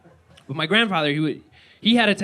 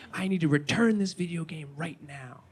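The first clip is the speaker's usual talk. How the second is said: whispered demand; 6 dB quieter than usual. Retold or imitated. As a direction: hiss the request across the counter. Mom whispers demand at counter.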